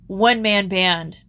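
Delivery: ordinary talk, nothing marked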